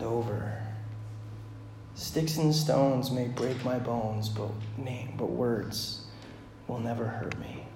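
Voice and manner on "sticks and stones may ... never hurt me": softer, slow, disillusioned saying; quiet, hollow repeat of the rhyme; bitter, quiet recital. Shown as a demonstration. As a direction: say it like you no longer believe it. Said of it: "If only that was true".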